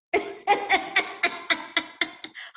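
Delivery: breathy unvoiced laugh at her own joke. Real, not acted. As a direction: laugh out, amused at herself.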